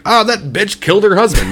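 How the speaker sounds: high, loud scandalized gossip; mocking gossip voice. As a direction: squeal it like a gossip. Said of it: "maybe we shouldn't be like".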